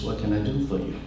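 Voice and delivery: normal talking voice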